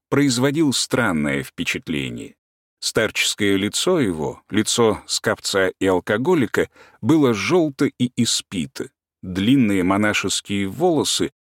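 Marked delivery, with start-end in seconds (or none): none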